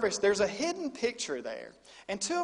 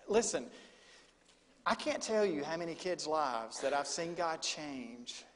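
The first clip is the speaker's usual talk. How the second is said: usual speaking voice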